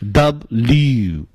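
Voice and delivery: "W" slowly as "dub-lyoo", in two beats; careful teaching "W", dub-lyoo; run-together pronunciation. Shown as a demonstration. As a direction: say "W" with a long final oo. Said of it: "把它念顺口了就成了".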